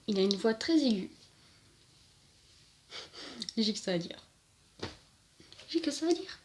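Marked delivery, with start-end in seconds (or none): 5.7-6.4 much higher, lilting up at the end; squeaked-up echo of the line; high-pitched repeat. Real, not acted. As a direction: repeat it in a comically raised pitch. Comic repeat of own line.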